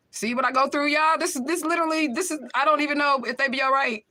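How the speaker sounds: high, loud voice of distress; crying out in worry; real anguish, pitch high and loud. Real, not acted. Mother distressed about her kids.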